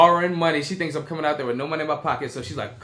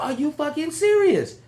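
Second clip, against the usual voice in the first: high, slow, stunned question; can't believe what he's hearing; stunned disbelief; 11 semitones higher, slower than usual. Retold or imitated. Stranded in the parking lot, high stunned disbelief. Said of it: "I was like".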